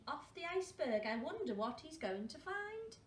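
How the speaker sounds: ordinary delivery, nothing marked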